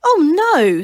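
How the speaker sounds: sharp high horrified cry. A narrator's reading. Priest to the tribe, shocked by the gnomes, loud horrified cry. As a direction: recoil in loud horror.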